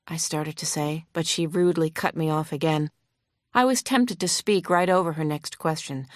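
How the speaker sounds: plain reading voice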